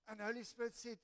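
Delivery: ordinary talk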